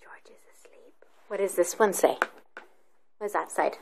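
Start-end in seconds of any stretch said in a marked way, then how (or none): 0.0-1.1 hushed whisper for a sleeping character. Real, not acted. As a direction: whisper it so he stays asleep. Reading asleep page.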